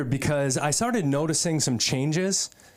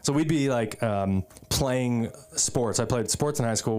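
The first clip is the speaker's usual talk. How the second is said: ordinary delivery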